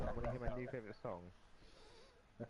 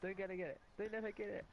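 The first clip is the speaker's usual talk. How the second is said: urgent callout; 8 semitones higher than usual. Real, not acted. Urgent game callouts, high.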